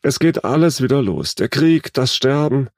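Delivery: lightly voiced troubled old woman, not truly whispered; distressed old lady. A narrator's reading. Old lady with eyes closed tells her daughter, distressed. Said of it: "flüsterte die alte Dame".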